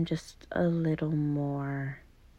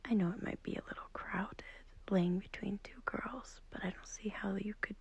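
hushed whisper, much softer; 5 dB quieter than usual. Acted. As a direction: whisper so the sleeper beside you doesn't wake. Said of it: "I'm whispering because I don't want to wake up".

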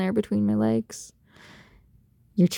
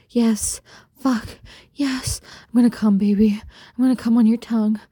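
higher, breathless moaning; acted climax; 5 semitones higher, slower than usual. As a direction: gasp the words out between moans. Acted climax in intimate roleplay.

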